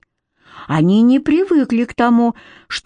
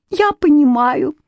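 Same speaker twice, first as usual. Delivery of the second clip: high tearful fairy voice; sobbing; 6 semitones higher than usual. A narrator's reading. Fairy accepting her banishment, tearful and high. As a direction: squeeze it out high through tears. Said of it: "всхлипывая, выдавила она".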